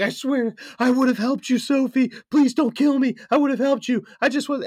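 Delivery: loud, high-pitched panicked pleading; freaking out. Acted. Sleeper begging the ghost not to kill him, frantic and high. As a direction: beg for your life in a hammed-up terror. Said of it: "he's, like, freaking out".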